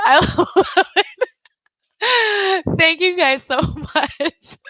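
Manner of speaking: high, tearful laughing thanks; laughing hard. Real, not acted. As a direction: squeal it through helpless laughter. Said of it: "haven't laughed that hard".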